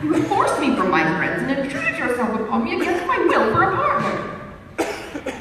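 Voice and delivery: high, loud protesting voice; young lady protesting to her pushy partner; heated exchange. A narrator's reading. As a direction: let the indignation rise.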